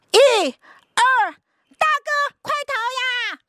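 high, loud urgent shouts; excited kids shouting. Acted. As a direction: heave and cheer together. Brothers rescue, cheering.

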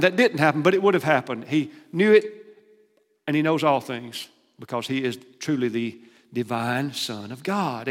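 normal talking voice

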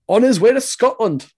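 a hammed-up, clumsy Scottish lilt; horrible Scottish accent. Co-host tries a Scottish line about the transfer, bad Scottish accent. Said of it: "that's a horrible Scottish accent".

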